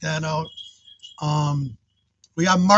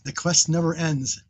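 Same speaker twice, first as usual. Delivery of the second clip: normal talking voice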